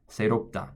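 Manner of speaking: 새롭다 as "seh-rop-ta" with a light tapped R; correct Korean pronunciation. Shown as a demonstration. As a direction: say 새롭다 the Korean way, quick and connected. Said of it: "It's supposed to sound like".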